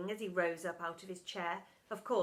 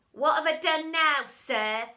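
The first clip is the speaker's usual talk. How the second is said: cheeky, loud innocent reply; smirking; 5 semitones higher, 10 dB louder than usual. A narrator's reading. Bog answering his teacher, smirking cheek. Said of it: "replied Bog with a smirk".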